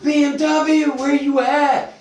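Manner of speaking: high, loud holler; imitated yell. Retelling his friend hunting for him in Walmart, imitated yelling. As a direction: yell it like calling across a store. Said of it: "this motherfucker yelling through Walmart".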